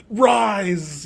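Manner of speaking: lightly hammed anime villain command; a hint of an anime overlord; overacted anime dub. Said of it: "an anime character, but a guy who's like".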